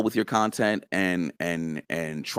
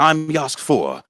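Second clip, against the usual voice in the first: proud character voice, raised pitch; Yask; 6 semitones higher, 4 dB louder, much slower than usual. Shown as a demonstration. Actor shows how he becomes his character, proud declaration. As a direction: announce yourself as the mighty hero. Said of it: "when I'm thinking I'm Yask".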